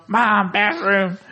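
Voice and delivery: drawn-out high mock yell; high cartoon holler, no real volume; Cartman. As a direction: stretch it out like a cartoon kid calling mom. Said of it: "He yells the intercom".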